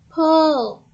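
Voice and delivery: loud, high drawn-out heave-ho call; shouted command. A narrator's reading. Wife and farmer tug the turnip, shouted. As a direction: shout it to rally the pullers. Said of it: "the wife shouted".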